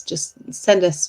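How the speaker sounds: ordinary talk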